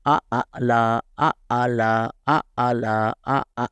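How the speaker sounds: آ plain then ع from throat vibration; alif versus ain. Shown as a demonstration. Teacher contrasting alif and ain sounds aloud. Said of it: "آ جو ہے یہ simple ہے".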